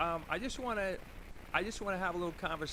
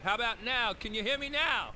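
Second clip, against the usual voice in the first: to someone hard of hearing; 5 semitones higher, 6 dB louder than usual. Real, not acted. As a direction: speak up so he can hear. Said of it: "I'm going loud".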